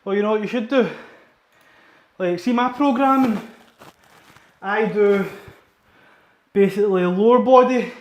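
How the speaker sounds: breathless after a heavy set; talking while catching breath. Real, not acted. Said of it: "I'm just catching my breath".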